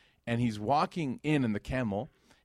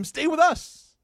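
high, loud eager plea; eager invitation; 8 dB louder, much slower than usual. Retold or imitated. Townspeople calling to the Prophet, much higher eager plea. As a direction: call out to him, begging him to stay. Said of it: "they said to him".

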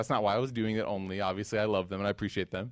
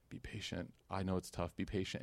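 whispered, much softer comfort; gentle hush; 5 semitones lower, 12 dB quieter than usual. Retold or imitated. Prophet gently comforts a crying woman, whispering. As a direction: hush it like consoling someone grieving.

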